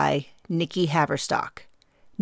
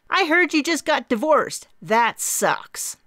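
much higher, bright blunt sympathy; blunt Mary; 9 semitones higher, 4 dB louder, slower than usual. A narrator's reading. Mary bluntly mentions the divorce, high and bright. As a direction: blurt it out cheerfully. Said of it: "fast-clipped tone".

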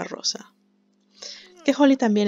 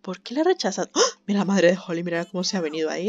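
normal talking voice

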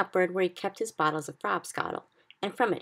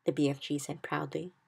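ordinary delivery, nothing marked

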